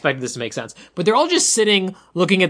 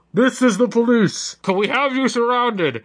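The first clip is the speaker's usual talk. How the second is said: through a megaphone; 5 semitones higher than usual. Retold or imitated. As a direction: call it out like a cop on a loudspeaker. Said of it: "this is how it sounds, guys".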